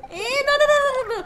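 high, loud string of no's; loud protest. Real, not acted. Streamer loudly refusing chat's question, high and loud. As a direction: shout the denial up high.

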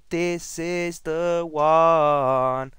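slow, drawn-out hype; dramatic build-up. Real, not acted. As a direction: stretch it out like a big reveal. Building hype before the big reveal.

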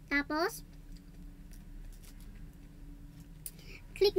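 talking with food in mouth; eating salad between words; chewing while talking. Real, not acted. Seen 1: Mouth closed, cheeks working between bites; lettuce on fork throughout.

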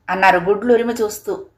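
ordinary delivery, nothing marked

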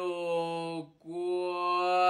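drawn out low and slow for suspense; suspenseful slow. Acted. Teacher calls the next student, slow and suspenseful. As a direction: stretch the number out like a dumb announcer. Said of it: "¿por qué habla como tonto?".

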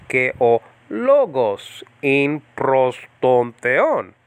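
slow, careful, each word spaced; teacher slowing it down for learners. Shown as a demonstration. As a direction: ke o logos in prostontheon, drawn out slowly. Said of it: "Now say it slower".

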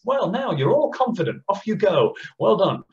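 mock cheery teacher. Teacher praising class in mock.

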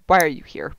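"Why are you here" low and falling, as a statement; no question mark. Retold or imitated. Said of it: "No question mark".

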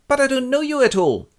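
high, loud bewildered protest; hammed-up confused boy; puzzled youth. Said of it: "And Melchior goes".

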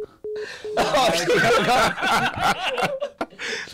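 high wheezing laugh; helpless laughter. Real, not acted. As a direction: laugh till it breaks. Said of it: "I couldn't do it".

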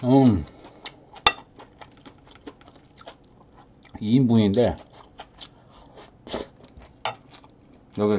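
talking between bites of rice; muffled words around food; mouth full while chewing. Real, not acted. Seen 2: Cheeks puffed and chewing throughout; new spoonful taken at 4.7-6.4s.

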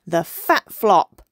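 loud, slow announcement, pitch kept down; yelled. A narrator's reading. Boy dolphin names his splash move, yelling. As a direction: punch out each word loudly. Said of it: "yelled Hector".

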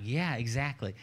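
quiet, cocky trash talk; soft, casual taunts as if under the breath; mocking undertone. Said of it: "he was mocking Goliath the whole time".

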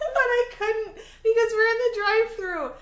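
high and loud through laughter; words bubbling with giggles; laughing. Real, not acted. Recalling the drive-thru, laughing.

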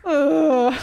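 high, loud drawn-out groan; hammed-up woozy groan; loud acted groan. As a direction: groan as the character collapses. Character collapsing after being drugged.

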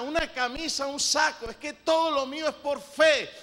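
loud pulpit preaching; full-force preaching. Acted. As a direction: drive the point home like a fired-up preacher.

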